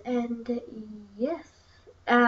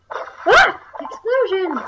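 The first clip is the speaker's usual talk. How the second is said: high, loud burst of excitement; yelp, then exclaim it high; excited outburst; 6 semitones higher, 6 dB louder, much slower than usual. Real, not acted. Gamer reacting to explosions on screen, high excited yell.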